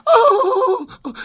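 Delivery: high, loud squawk of surprise; long shrieked oh, hammed up; surprised squawk. A narrator's reading. Women passengers seeing the armed man, squawking in surprise. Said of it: "withdrew from the windows with squawks and surprise".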